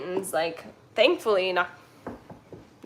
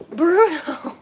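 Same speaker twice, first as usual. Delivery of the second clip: calling out; 8 semitones higher, 4 dB louder than usual. Real, not acted. Calling the dog Bruno.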